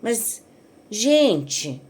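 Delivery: ordinary delivery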